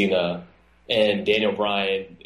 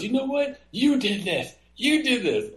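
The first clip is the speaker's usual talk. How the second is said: much higher, taunting heel voice; Orton taunting; 13 semitones higher than usual. Retold or imitated. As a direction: gloat over the beaten opponent. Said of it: "He goes".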